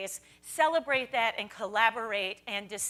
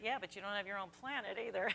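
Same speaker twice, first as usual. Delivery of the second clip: quiet quick comeback; 4 semitones lower, 13 dB quieter, faster than usual. Retold or imitated. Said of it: "I was like".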